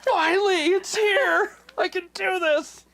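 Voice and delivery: very high, loud hammed-up squeal; mock battle-ready glee. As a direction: squeal like a giddy would-be Rambo. Jokey Rambo fantasy of intruder arriving.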